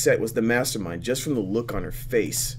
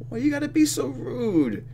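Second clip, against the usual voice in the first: drawn-up, high-pitched complaint; high whiny protest. Real, not acted. Player protesting at the rival's rudeness, whiny. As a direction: whine it in a much higher voice.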